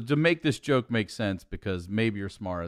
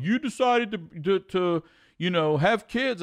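mock critic; 8 semitones higher, 3 dB louder than usual. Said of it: "people are like".

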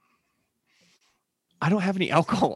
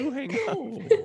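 giggly late night call; 13 semitones higher, 6 dB quieter, slower than usual. Pretend. Mock phone goodbye, laughing.

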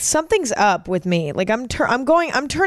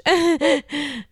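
fake laugh; 7 semitones higher than usual. Real, not acted. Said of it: "fake laugh at my own jokes".

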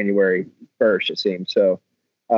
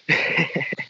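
soft laugh; 7 semitones higher, 4 dB quieter than usual. Real, not acted. Laughing during massage.

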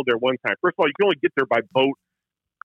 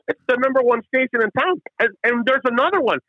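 put-on Newfoundland accent; 9 semitones higher than usual. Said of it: "they all talk like Wisconsin on steroids".

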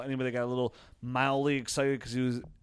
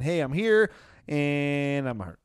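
Marquise Goodwin; 3 semitones higher, 6 dB louder, much slower than usual. Acted. Host voices a receiver briefly back then hurt, mock announcement. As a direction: pipe up brightly, then stretch out the bad news.

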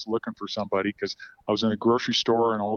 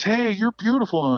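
higher-pitched imitated call-out; retold catcall, raised in pitch; mock yell; 10 semitones higher, 4 dB louder than usual. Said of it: "he yells down at me".

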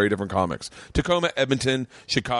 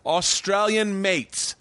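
put-on a slow, higher, half-hearted Australian accent; failed Australian accent; 8 semitones higher, much slower than usual. Host greeting Australian fans in a weak Australian accent. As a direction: stretch it out in a weak try at Australian. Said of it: "Didn't sound Australian".

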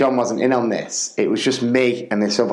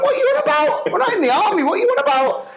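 high-pitched, laughing mock exasperation; incredulous protest; faster than usual. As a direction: challenge a colleague's absurd seriousness. Incredulous reply to soldier talk.

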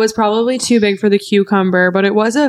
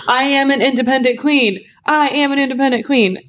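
higher-pitched, hammed-up declaration; mock proud; 4 semitones higher than usual. Host boasting she can manage alone, mock proud. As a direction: proclaim it like self-styled royalty, tongue in cheek.

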